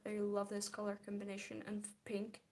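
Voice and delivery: ordinary talk, nothing marked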